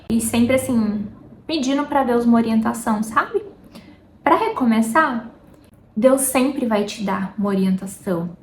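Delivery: normal talking voice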